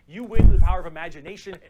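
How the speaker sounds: big, booming announcement; over-the-top hype; mock-triumphant shout. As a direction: proclaim it loudly like a prize. Mock-heroic outburst about orc logic.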